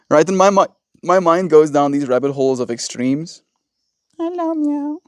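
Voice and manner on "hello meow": very high cooing pet voice. Real, not acted. Vlogger pausing to coo at the cat, high baby talk. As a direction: squeak it up high for the kitty.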